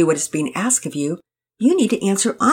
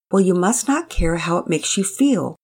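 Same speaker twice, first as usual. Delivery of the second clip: ordinary delivery, nothing marked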